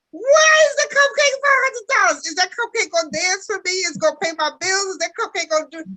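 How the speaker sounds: indignant. Pretend. Mock outraged customer over cupcake price.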